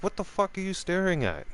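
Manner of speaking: high-pitched, indignant retold question; indignant challenge. Drinker confronting the man across the table, high indignant challenge. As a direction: push the question up high, then drop at the end.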